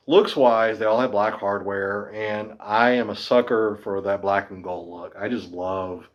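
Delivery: usual speaking voice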